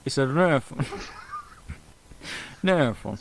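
a hint of a laughing, mangled mobster; half-laughing mock mobster growl. Mobster threatening Nathan, mangled mock accent. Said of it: "this is what the mobster sounds like".

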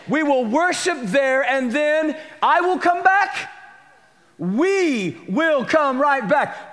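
high, slow, shouted proclamation; climactic preaching. Acted. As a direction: shout the promise slowly. Peak of sermon.

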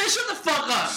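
high-pitched mock exasperation; retold irritated comeback. Friend retelling brushing off a little brother, high exasperated retort. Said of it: "I was like".

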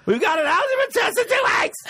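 very high panicked shriek; hammed-up frantic outburst; flustered girlfriend. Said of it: "she'd get all flustered".

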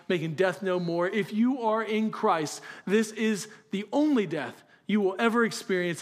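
ordinary delivery

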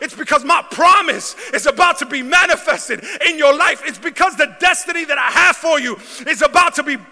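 building from calm to loud, high shouting; preaching crescendo. Acted. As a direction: build it up to a triumphant roar.

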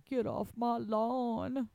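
put-on grumpy old man. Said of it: "start screaming".

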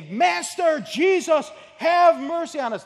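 high, loud cry for mercy; desperate shout. Retold or imitated. Lepers crying out to Jesus from afar, desperate shout. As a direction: scream it across the distance, begging. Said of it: "and they say".

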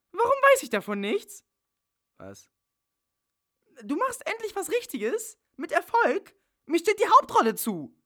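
indignant actress screaming for her part; furious yelling. Acted. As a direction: yell at him like you've been betrayed. Bella storms in, demands role.

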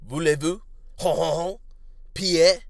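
a lightly hammed-up fake French accent; mock French accent. Podcaster riffing on what he knows about France, mock French accent. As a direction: toss off a cheesy French accent as a joke.

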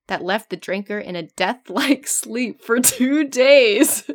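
slowed, laughing through the words. Real, not acted. Reader cracking up at the death-like sleep, laughing. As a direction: giggle while reading.

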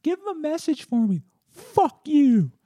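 rude nurse. Retold or imitated. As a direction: imitate a nasty nurse sneering insults. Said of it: "the nurse is like".

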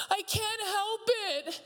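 put-on high, wailing little-boy whine; high whimpering child through tears; four-year-old whining. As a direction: wail it like a crying four-year-old son. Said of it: "And he goes".

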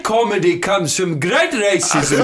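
slow, put-on South African English; South African accent. Shown as a demonstration. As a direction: land the punchline slowly in a South African accent. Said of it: "South African".

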